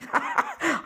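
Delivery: a short, squeaky laugh that drops away; high burst of laughter. Real, not acted. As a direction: laugh up high at your own fuss. Laughs at her own annoyance.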